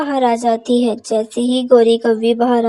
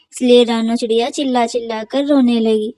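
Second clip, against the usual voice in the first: usual speaking voice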